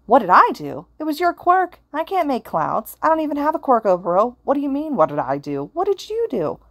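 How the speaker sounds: high, loud, panicked disbelief. Acted. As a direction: push back in a rising panic. Izuku's panicked protest.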